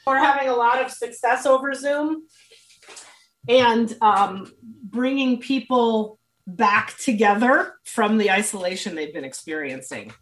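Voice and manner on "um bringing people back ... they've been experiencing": ordinary talk, nothing marked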